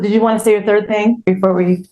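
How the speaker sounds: normal talking voice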